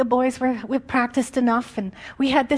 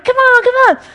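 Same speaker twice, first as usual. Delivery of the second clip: loud, much higher, gleeful call; playful invitation; 13 semitones higher, 12 dB louder than usual. Retold or imitated. Jesus calling Maggie to jump in puddles, gleeful and loud. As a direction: beckon like a joyful child at play.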